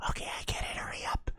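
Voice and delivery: hushed unvoiced whisper. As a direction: breathe the words, no voice. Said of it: "why are you whisper".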